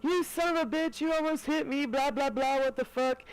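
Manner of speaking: hammed-up high-pitched yell of an angry cyclist; retold yell. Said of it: "yelling at the top of his lungs".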